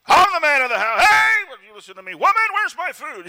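mock bossy shout, high and loud; tyrant husband yelling. Preacher mocks a tyrant husband, hammed-up angry shout. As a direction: bark orders like a household tyrant. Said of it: "rule with an iron fist as tyrants".

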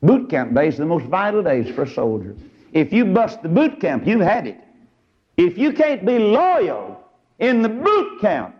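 high, ringing pulpit voice; preaching at full force. Acted.

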